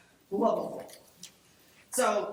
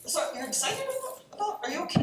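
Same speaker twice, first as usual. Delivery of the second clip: puzzled friends; 6 semitones higher, faster than usual. Retold or imitated. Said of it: "they're like".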